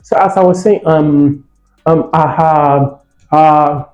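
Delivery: halting speech full of drawn-out fillers; hesitant, unfluent. Shown as a demonstration. Teacher imitates a non-fluent conference speaker, halting with fillers. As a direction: stall on long ums and uhs.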